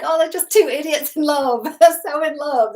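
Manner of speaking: high and loud, swooning over the couple; gushing delight. Retold or imitated. Fan watching the couple on screen, gushing high and loud. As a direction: gush like a fan watching two lovebirds. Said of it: "I'm like".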